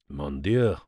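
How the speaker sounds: put-on low-pitched, accented exclamation. A narrator's reading. Poirot reacts to the tetanus death in a very low voice. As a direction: let the French oath drop low. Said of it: "in a very low voice".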